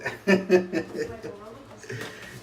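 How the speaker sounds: unvoiced high laughter; breathy laugh. Real, not acted. Dad cracking up at the card, airy laugh.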